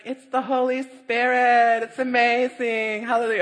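high, loud, slow gushing prayer voice; rapturous church friend; hammed-up high ecstatic praise; ecstatic believer. Genevieve praying ecstatically.

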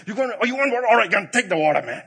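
loud, high exasperated Moses yelling at the crowd; exasperated shout. Retold or imitated. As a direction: fling it at them in a loud fit of temper. Moses snapping before striking rock.

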